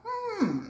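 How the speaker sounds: high-pitched musing hum; a high, held "hmm" of retold wondering; pondering hmm. Telling viewers what she thought on seeing soy candles, pondering hmm. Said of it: "And I thought".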